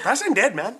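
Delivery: put-on Pakistani accent. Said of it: "He just said it so quick".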